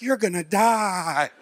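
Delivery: slow, drawn-out mock doom; mock terror. Comedian showing how scared he was, drawn-out mock terror. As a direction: stretch it out in hammed-up dread. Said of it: "I'm talking scared like".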